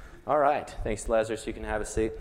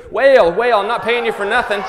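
much higher and louder, a hammed-up family boss; bossy paying mourner; 11 semitones higher, 14 dB louder than usual. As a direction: bark at hired mourners to wail harder. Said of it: "paid people to come and wail".

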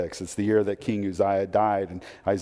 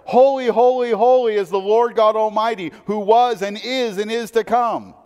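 much higher and louder, ringing out "Holy, holy"; angelic proclamation; 12 semitones higher, 8 dB louder than usual. Acted. Angels praising God before the throne, ringing loud proclamation. Angels' cry voiced in sermon.

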